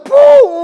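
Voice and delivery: loud, high "pum" of a crash; imitated bang. Preacher imitates a noise heard from home, loud bang. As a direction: boom out a sudden bang. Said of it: "escucha un ruido".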